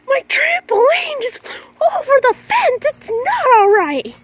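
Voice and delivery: high, slow, crying shout; overdramatic wail. Retold or imitated. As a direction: wail it like a teen melting down. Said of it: "I got a little dramatic about that".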